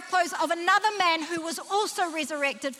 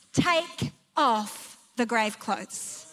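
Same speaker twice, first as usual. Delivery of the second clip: very slow, lower, word by word; solemn instruction; 5 semitones lower, much slower than usual. Retold or imitated. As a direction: let each word land with weight. Preacher repeats Jesus' instruction solemnly.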